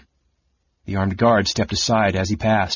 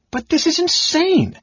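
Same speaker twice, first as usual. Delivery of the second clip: much higher, louder, slow and strained cry; 4 dB louder than usual. Acted. Chrysania to Caramon, crying out in desperation. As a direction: let it burst out of her, voice cracking. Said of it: "Chrysania cried".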